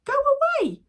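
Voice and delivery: high, loud yell; angry shout. Acted. Father yelling at ducks to go away. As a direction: drive them off.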